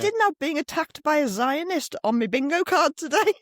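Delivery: high-pitched, comically put-out reply; retold shaken indignation. Telling her protest friend about the attack, comically rattled. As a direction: act out being rattled, pitched well up.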